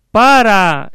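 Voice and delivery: high, drawn-out distant call; echo. Acted. Voice answering from the other side, echoing call. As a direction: stretch it out like an echo, falling at the end. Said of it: "el eco es todo".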